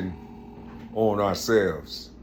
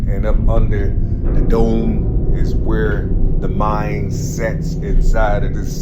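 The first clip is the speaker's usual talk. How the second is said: fired-up preaching; 7 dB louder than usual. Real, not acted. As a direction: preach it hard to the crowd, getting louder. Rising street preaching about God.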